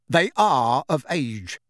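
slow, tired insistence; weary. A narrator's reading. Tired husband correcting his wife, weary and slow. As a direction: spell it out slowly, worn down. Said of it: "said Mister Weasley wearily".

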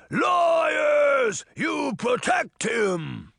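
loud, high, drawn-out giant accusation; shouted giant's growl. A narrator's reading. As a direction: growl it as a furious giant, slowly. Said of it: "the giant growled".

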